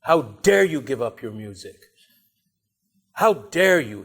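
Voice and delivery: mild mock yell, indignant, leaning on "dare"; imitating an angry reproach; retold angry yell. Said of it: "he started yelling at Yusuf".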